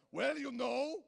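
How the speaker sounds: a light Chrétien impression, rambling. Chrétien answering a reporter's Iraq question, rambling lightly. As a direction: muddle the answer like a cryptic politician. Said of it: "his answer would be like a secret code".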